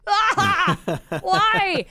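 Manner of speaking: high, loud cry of dismay through laughter; playful over-the-top lament; mock wail. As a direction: wail it in exaggerated despair, half laughing. Acting out missing the show.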